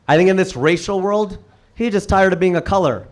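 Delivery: ordinary talk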